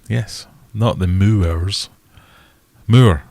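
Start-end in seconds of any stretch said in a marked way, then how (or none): 2.9-3.2 loud Moor, spoken as moo then r. Shown as a demonstration. Streamer teaching chat how to say Moor. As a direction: Moor rhymes with poor, not more. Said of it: "Put an R on the end".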